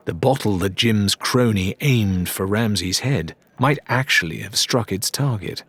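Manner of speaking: plain reading voice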